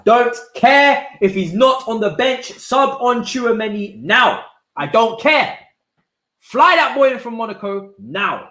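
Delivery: desperate fan pleading; frantic demand. Real, not acted. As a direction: demand it, voice rising. Demanding substitution.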